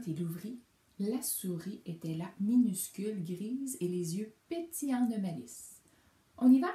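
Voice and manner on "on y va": high, loud, bursting to go; the little mouse can't wait to leave; eager exclamation. A narrator's reading. Mouse urging the bear to leave, loud and eager. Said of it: "s'exclama-t-elle".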